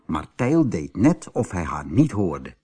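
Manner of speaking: plain reading voice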